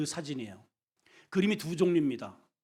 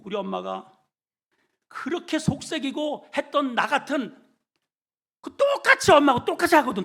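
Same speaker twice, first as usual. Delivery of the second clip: normal talking voice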